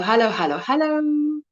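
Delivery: usual speaking voice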